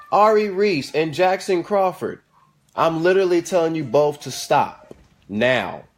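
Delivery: slow, firm warning with full names; stern scolding. Real, not acted. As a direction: spell it out so they know you mean it. Scolding the kids by full name.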